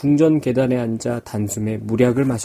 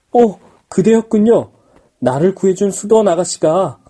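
raised, joyful; delighted surprise; 6 semitones higher, 4 dB louder than usual. Acted. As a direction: greet her with glad surprise. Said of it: "너무나 놀랍고 기뻤어".

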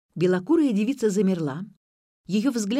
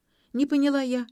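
ordinary delivery, nothing marked

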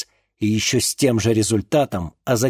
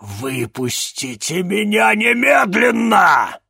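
slow roaring demand to be freed; demon growl; 10 semitones higher, 6 dB louder, slower than usual. A narrator's reading. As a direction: roar it out like a caged demon. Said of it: "прорычала Лакабал".

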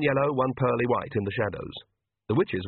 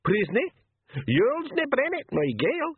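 high-pitched little pixie voice; tiny creature, high and questioning. A narrator's reading. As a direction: squeak it in thick dialect. Blue pixie answers in dialect.

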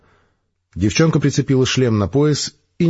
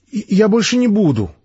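put-on higher, meek girl's promise; a meek promise from a girl, higher pitched; girl promising the major to behave, meek and higher; weak exhale; 11 semitones higher than usual. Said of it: "слабо выдохнула девчонка".

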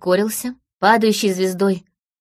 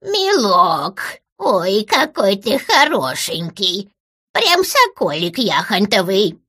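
put-on slow, creaky old hag voice; much slower than usual. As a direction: croak it out like a doting witch. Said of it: "проскрипела дребезжащим голосом я".

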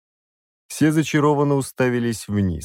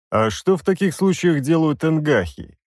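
higher, mocking question; sarcastic question; 6 semitones higher than usual. A narrator's reading. Demon sarcastically asks the parasite. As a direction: needle him with sarcasm. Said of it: "язвительно спросил я".

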